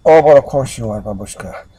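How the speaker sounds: put-on Russian accent with a loud opening; knowing Russian-accented reassurance. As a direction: exclaim in a Russian accent, then drop off. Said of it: "Russian accent".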